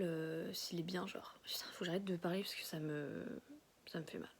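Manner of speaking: barely whispered through a sore mouth; after wisdom teeth. Real, not acted. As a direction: speak soft and careful, jaw hurting. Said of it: "J'arrive toujours pas bien à parler".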